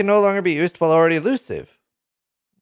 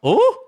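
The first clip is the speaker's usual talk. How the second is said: surprised ooh; 4 dB louder than usual. Real, not acted. Sees skill in patch notes.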